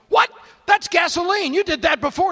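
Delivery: high, loud protest; outraged shout. Retold or imitated. Patient yells at the doctor after gasoline in his ear. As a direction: yell out in indignant shock.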